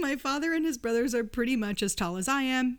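usual speaking voice

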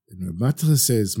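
plain reading voice

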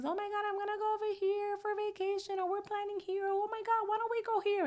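high, fast gushing about trips; mock excited friends. Friends bragging online about trips, in a mock high gush. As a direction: gush like a friend planning a vacation. Mocking friends' vacation posts.

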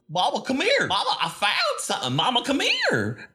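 high, eager little chicken pleading; squeaky chicken voice. Acted. The chicken urging the players inside, high and squeaky. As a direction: pitch it way up, urgent and small. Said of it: "he looks at you and goes".

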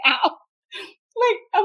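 usual speaking voice

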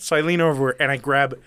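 normal talking voice

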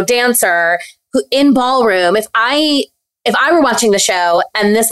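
normal talking voice

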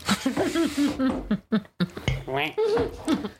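long, helpless laughing fit. Real, not acted. Cracking up after the cheers, long laugh. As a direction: keep laughing, real and unforced.

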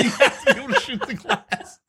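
laughing, pitched high. Real, not acted. Excited gamer laughing about the Die Hard map. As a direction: laugh through the line up high.

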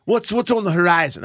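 lightly voiced smug billionaire owner; a hint of a wealthy tycoon; rich guy voice. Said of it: "That's my rich guy voice".

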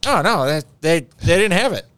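lightly acted phone fib; imitated evasive husband. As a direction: mimic a husband fumbling an excuse. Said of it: "And he's like".

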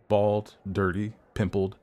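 usual speaking voice

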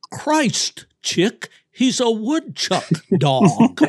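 a northeastern Yankee accent, high and drawn out, in a mocking tone; mock New Jersey Yankee exclaiming, slowly and loudly. Yankee accent for the hunter from New Jersey, amazed. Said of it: "in his Yankee accent".

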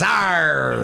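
lightly voiced pirate arr; pirate growl. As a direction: give a quick pirate arr. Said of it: "International Talk Like a Pirate Day".

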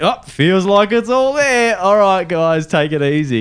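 high, loud, slow dopey bloke; idiot uncle voice. Retold or imitated. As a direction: play the clueless matey guy. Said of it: "total fucking idiot".

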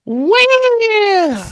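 put-on high wailing baby cry; cartoon crybaby. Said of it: "read it in a crybaby voice".

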